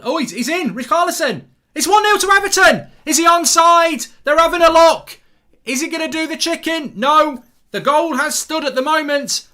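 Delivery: high, loud burst of excitement; excited goal commentary. Real, not acted. Watchalong host calling Everton's goal live, excited shouting.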